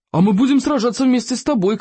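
high-pitched cry of loyalty; eager shout. A narrator's reading. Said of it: "крикнул Сморкало". (задорный выкрик)

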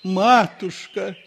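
very high, loud, slow tender plea; touched tenderness. A narrator's reading. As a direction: melt with emotion. Priest fawns.

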